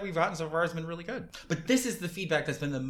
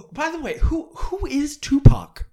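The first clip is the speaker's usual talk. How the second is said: higher, louder, slowed question; Sam Neill line; 10 semitones higher, 10 dB louder, slower than usual. Acted. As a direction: ask it up high and drawn out. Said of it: "Sam Neill finally goes like".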